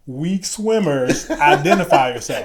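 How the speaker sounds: loud, drawn-out instructor call-out; mock instructor announcement, slow and pointed; pointed repeat. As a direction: stretch it out loud and high, aimed at one person. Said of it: "come stand closer to me so he can repeat it".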